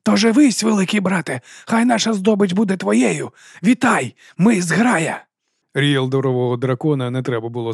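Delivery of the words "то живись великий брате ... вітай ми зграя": rally the pack, bright and urgent; wolf voice. A narrator's reading. Wolf Nightseer urging the dragon to feed, eager and high. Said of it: "Нічноокому вистачило глузду відповісти".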